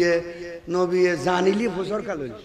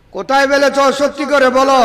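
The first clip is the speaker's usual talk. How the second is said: pressing demand; 5 semitones higher, 8 dB louder, faster than usual. Acted. Prophet presses Umm Ayman.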